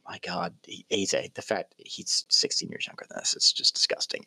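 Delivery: hushed, breathy muttering to himself; whispered aside. Real, not acted. Muttered aside about age.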